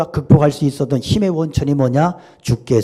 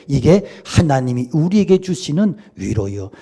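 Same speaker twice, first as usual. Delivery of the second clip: ordinary talk, nothing marked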